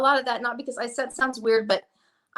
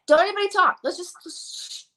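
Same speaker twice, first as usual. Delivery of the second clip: mock shushing; 7 semitones higher than usual. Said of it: "I'm like".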